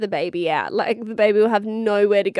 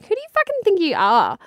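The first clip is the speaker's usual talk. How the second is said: high, teasing fake indignation; play-scold the toddler for her demand; higher-pitched joking huff at her daughter; mock outrage; 9 semitones higher than usual.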